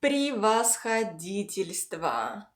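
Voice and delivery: превосходительство slowly, syllable by syllable; slow pronunciation demo. Shown as a demonstration. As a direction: draw out превосходительство for learners. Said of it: "the second part is".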